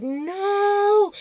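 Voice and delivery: a high, loud wail of no; anguished cry. Retold or imitated. Child finding the tree cut down, high loud anguished cry. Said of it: "and I was like".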